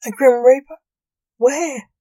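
high, loud alarmed cry; horror. A narrator's reading. Ghost hearing of the Grim Reaper, loud and horrified. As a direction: yelp it out in sudden fright. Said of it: "said the ghost in horror".

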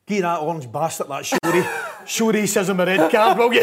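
slow, building to a loud laughing punchline; laughing mock jibe. Tam heckling the latecomer over the card, retold laughing. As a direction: retell the heckle, cracking up midway. Quotes Tam's jibe, cracking up.

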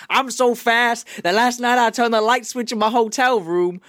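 put-on high, loud boxer's boast in a rough southern accent; hammed-up southern braggart, breaking into laughter; Muhammad Ali. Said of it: "I can't do his accent".

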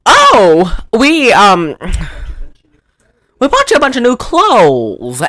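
high, loud, hammed-up aunt; theatrical aunt with drawn-out exclamations; Aunt Martha. Aunt presenting new clothes to a child, hammed-up character voice. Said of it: "Aunt Martha's going".

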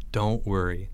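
low, soft reassurance; calm a worried friend; reassuring. A narrator's reading. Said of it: "Leo assured him".

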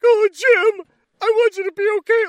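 high, loud hammed-up sob-talk; mock crying. Recapping the car scene, mock tearful plea to Jim. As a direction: overact a weepy plea. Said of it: "crying with Jim".

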